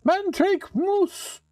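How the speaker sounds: high, loud cackling witch casting a spell. Acted. Witch cursing the player into a frog, high and gleeful.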